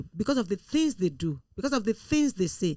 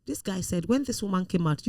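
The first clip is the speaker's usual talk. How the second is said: normal talking voice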